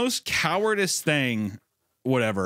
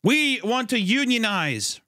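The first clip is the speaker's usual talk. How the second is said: slow, higher mock protest chant; mock demand; 9 semitones higher, slower than usual. Mocking scientists demanding a union, slow and high. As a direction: draw it out high like a picket line. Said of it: "There's just".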